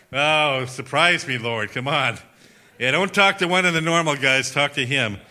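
mock-exasperated complaint to God; sarcastic grumbling. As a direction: grumble back with lively sarcasm.

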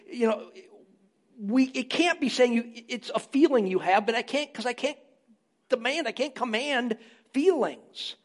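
ordinary talk, nothing marked

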